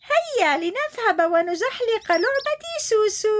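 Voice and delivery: high, eager little giraffe; giraffe suggests. Acted. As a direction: invite her sister to play. Giraffe proposes playing. (وردة تدعو أختها للعب)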